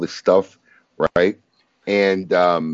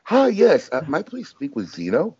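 high, overly polite phone voice; mock-polite phone request, voice pitched up; imitating Emily; 9 semitones higher than usual. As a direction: play the sweet caller asking for Zeno. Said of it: "she literally calls up the store and says".

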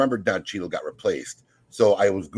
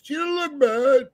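much higher, slow mock squeak of "She don't look bad"; slower than usual. Teasing Paul about his cracked voice, high mock squeak. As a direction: imitate Paul's voice jumping up high. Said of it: "Paul's voice went up two octaves".

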